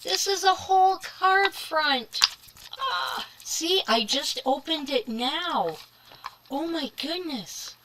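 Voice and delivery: high, loud, thrilled discovery; excited gasp. Real, not acted. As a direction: gasp and gush with delight. Discovering a whole card front.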